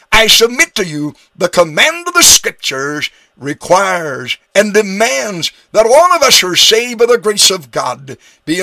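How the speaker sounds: preaching. Real, not acted. Preacher urging all the saved to work for God, preaching emphatically.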